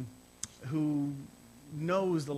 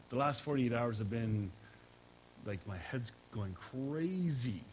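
normal talking voice

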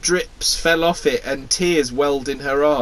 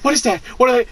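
startled; 9 semitones higher, 3 dB louder, much faster than usual. Acted. Voicing startled onlooker.

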